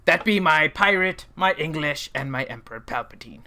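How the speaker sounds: hammed-up pirate accent. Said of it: "Chewie says I sounded like a pirate".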